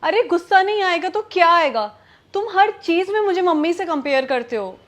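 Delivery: loud, indignant retort; angry. Acted. Wife answers husband's question about her temper, angry. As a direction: snap back loudly, fed up. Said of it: "इतना गुस्सा क्यों कर रही हो".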